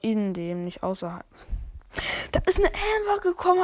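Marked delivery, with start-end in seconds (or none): 2.3-3.6 startled high voice. Real, not acted. Surprised by an enemy.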